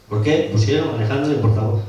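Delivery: normal talking voice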